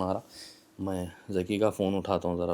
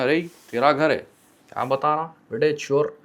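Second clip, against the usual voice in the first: higher, louder mock scolding landlord; 5 semitones higher, 4 dB louder than usual. Retold landlord scolding the tenant as a thief, mock scolding. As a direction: play the suspicious landlord accusing him.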